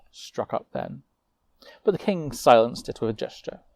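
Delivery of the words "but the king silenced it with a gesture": ordinary delivery, nothing marked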